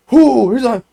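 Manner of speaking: high, loud imitated whoop; excited whoop. Friend seeing it pry off the wall, excited whoop. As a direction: whoop like you're winding up to kick. Acts kicker's excited cry.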